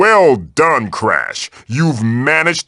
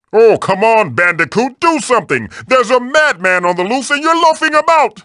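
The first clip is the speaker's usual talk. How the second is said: exasperated urging; 10 semitones higher than usual. Acted. Urging idle player, exasperated.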